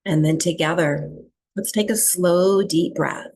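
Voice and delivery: calm, even meditation voice with long pauses; guided breathwork meditation. Real, not acted. Teacher settling a group into breathwork, calm meditation guiding. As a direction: guide them into stillness, leaving long silences between phrases.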